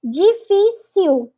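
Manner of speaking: "difícil" said as djifisiu, slow; Brazilian way. Shown as a demonstration. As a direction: Brazilian dj start and u ending in "difícil". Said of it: "seria com G, e com U no final".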